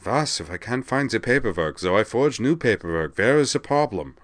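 hammed-up German self-justifying patter; muttering in German accent. A narrator's reading. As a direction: grumble to yourself in a bad German accent. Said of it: "muttering as he did so".

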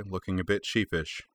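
plain reading voice

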